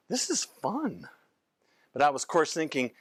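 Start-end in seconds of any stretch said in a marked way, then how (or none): 0.1-1.1 high, slow and gleeful; delighted. Retold or imitated. Doctor thrilled by the boy's change, high and slow. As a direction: savour the joy of it, slowly. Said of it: "And I'm like".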